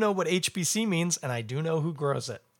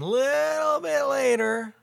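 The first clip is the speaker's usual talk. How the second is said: high drawn-out; 11 semitones higher, 6 dB louder, much slower than usual. Acted. High, stretched repeat of phrase.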